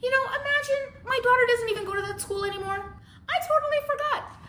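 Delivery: very high, airheaded aunt imitation; apologetic aunt. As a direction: mimic a clueless aunt way up high. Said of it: "Here goes my aunt".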